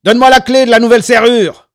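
high, very loud angry bellow; raging, full-voiced shout; furious yell. A narrator's reading. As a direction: burst in yelling abuse. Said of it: "hurla-t-il".

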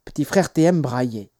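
ordinary delivery

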